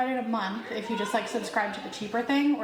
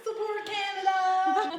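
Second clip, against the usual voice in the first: put-on higher, slow drunk cheer; mock drunken yell; 8 semitones higher, slower than usual. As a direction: imitate a tipsy dad bellowing at a concert. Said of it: "he was literally yelling".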